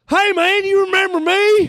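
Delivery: very high, sustained tourist yell; yelled greeting. Retold or imitated. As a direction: holler it at full volume on one high pitch. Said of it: "you just yelled like that".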